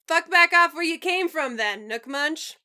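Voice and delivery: loud, playful grumble without venom; gruff teasing. Acted. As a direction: bark it but without real anger. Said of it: "Karkat says without venom".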